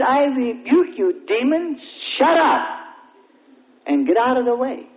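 high, forceful order bursting into "shut up"; acted-out fierce dismissal; commanding rebuke. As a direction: cast out the demon with authority. Minister acted out rebuking a demon.

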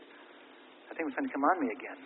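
whispered, low and soft; hushed quote. Retold or imitated. Preacher voicing the brother's whispered warning to him. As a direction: breathe it like a frightened secret. Brother senses the demon returning.

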